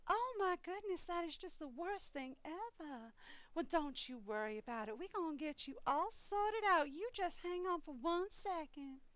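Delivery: put-on soothing an irate caller in a Southern Belle accent. Call center worker calming a screaming customer, sweet Southern Belle accent. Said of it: "slide into the Southern Belle accent".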